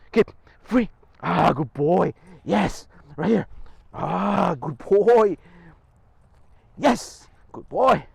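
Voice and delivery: excited dog praise. Real, not acted. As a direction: cheer the dog on as he works. Praising dog during training.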